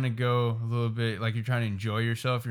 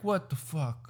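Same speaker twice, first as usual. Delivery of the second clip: higher, softer mock Italian exclamation; a hint of Italian accent, pitched up; 5 semitones higher, 5 dB quieter, slower than usual. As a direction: lightly imitate an indignant Italian. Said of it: "Like, this in Italian's like".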